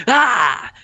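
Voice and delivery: high sudden shove yell; mock shove cry. Imagined cake lady throwing another out, sudden high yell. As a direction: bark it as she pushes. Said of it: "another lady would be like".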